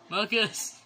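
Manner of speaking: loud sharp call of a name; exasperated shout for a little brother. Real, not acted. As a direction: call him out sharply across the room. Calling little brother to stop.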